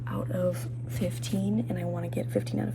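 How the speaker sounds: stressed whisper in a quiet room. Real, not acted. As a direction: whisper so nobody hears.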